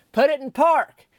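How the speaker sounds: loud high barked command; hollered order. Retold or imitated. Narrator hollers at woman reversing toward her husband, loud high command. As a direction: shout it like an order she can't ignore. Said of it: "when I hollered".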